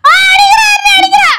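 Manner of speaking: high, loud wailing cry for help; hammed-up cry shout; mock crying shout. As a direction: wail like she's being beaten. Crying for help, faked beating.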